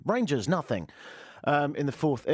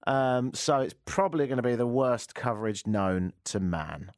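ordinary talk, nothing marked

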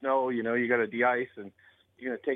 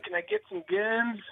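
higher-pitched hammed-up Texas accent; 8 semitones higher than usual. Shown as a demonstration. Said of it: "give me some stereotypes".

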